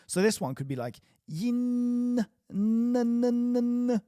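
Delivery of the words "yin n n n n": yin with the n held long, high and loud; exaggerated n. Shown as a demonstration. Teacher exaggerating the n of yin for students, loud and high. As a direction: really let the n hum. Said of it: "make the N really sound".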